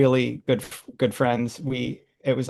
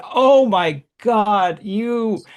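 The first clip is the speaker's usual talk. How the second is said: higher, louder and slow horrified cry; 7 semitones higher, 4 dB louder, much slower than usual. Retold or imitated. Meeting the flea circus performer, horrified. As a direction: recoil in horror as you say it. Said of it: "in horror".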